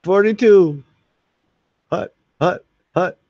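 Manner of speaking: loud, high snap count; quarterback call. Pretend. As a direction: bark the play like a quarterback. Mock football snap count.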